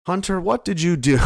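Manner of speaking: scolding call. Acted. Scolding Hunter.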